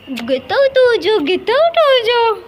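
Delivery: high, drawn-out get-out cry; dramatic dismissal. Acted. (नाटकीय ढंग से खींचकर, ऊँची आवाज़ में)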